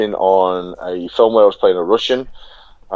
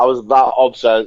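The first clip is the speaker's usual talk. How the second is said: ordinary delivery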